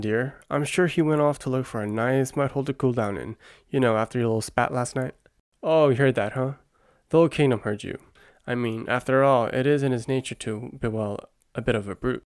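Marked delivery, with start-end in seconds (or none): none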